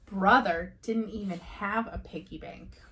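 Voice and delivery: ordinary delivery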